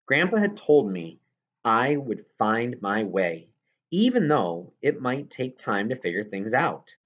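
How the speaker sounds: ordinary delivery, nothing marked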